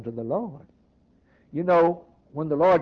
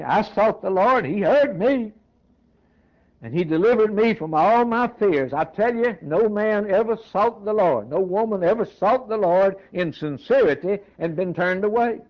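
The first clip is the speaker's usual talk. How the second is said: raised, emphatic preaching voice; fervent preaching; 5 semitones higher, 4 dB louder than usual. Real, not acted. Preacher testifying that seekers are never turned away, fervent preaching. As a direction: preach it high and insistent.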